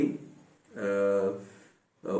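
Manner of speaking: ordinary talk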